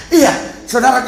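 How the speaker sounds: ordinary delivery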